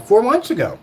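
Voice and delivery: outraged four months ago; incredulous. Real, not acted. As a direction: say it with outraged disbelief. Incredulous repeat.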